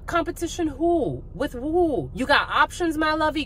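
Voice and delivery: high, sassy taunt; mock-sweet, high-pitched jab at a cheating partner; sassy mockery. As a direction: scoff at the rival with high, teasing disbelief. Mocking a partner with options.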